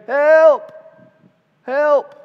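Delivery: high, very loud cry from down below; acted-out loud, high call for rescue; retold yell for help. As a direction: shout up for someone to come. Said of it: "she yells one more time".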